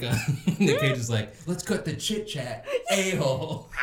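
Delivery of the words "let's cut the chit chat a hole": higher, slow, laughing mock anger; hammed-up irritation through a laugh; mock angry. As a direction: imitate an angry action hero, amused. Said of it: "so angry with him".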